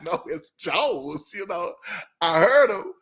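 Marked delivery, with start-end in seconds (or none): 0.0-1.3 slow, drawn "No, it's Jones"; slow correction. Retold or imitated. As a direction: spell it out for them. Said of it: "I was like".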